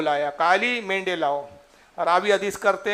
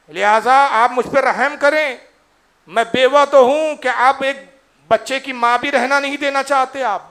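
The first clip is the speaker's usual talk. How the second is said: louder, higher desperate plea; pleading cry; 5 semitones higher, 7 dB louder than usual. Acted. Widowed mother begs Abu Bakr to spare her son, pleading loudly. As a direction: beg for mercy, voice lifted.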